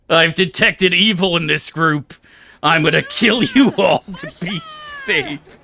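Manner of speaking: a self-righteous knight announcing a purge; zealous paladin. Retold or imitated. Paladin announces he'll kill the party, hammed-up zeal.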